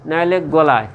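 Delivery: usual speaking voice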